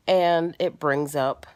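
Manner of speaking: normal talking voice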